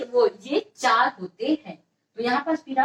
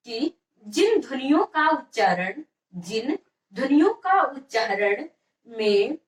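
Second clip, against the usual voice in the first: measured, word groups held apart; slow dictation. Shown as a demonstration. Teacher dictates a definition to students, slow dictation. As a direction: dictate slowly with pauses for writing. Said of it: "definition लिख लीजिए".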